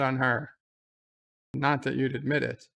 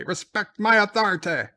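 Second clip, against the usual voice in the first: higher, louder shouted order; hammed-up yell of authority; mock shout; 7 semitones higher, 5 dB louder than usual. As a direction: bellow the command in mock outrage. Same line, shouted again.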